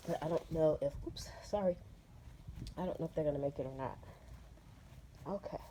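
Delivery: stuffy nose, sniffling between words. Real, not acted. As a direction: talk through a runny nose. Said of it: "Sorry if I sound gross".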